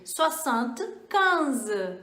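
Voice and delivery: "soixante et onze" spoken slowly; slow model. Shown as a demonstration. Teacher spells out seventy-one slowly for learners. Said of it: "eu vou falar sessenta quinze".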